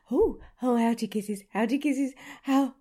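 ordinary delivery

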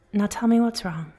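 usual speaking voice

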